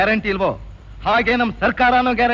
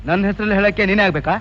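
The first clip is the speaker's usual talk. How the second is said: normal talking voice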